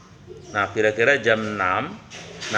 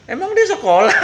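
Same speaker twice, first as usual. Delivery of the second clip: scoffing laugh; 14 semitones higher, 5 dB louder than usual. Acted. Hunter scoffs at the Prophet.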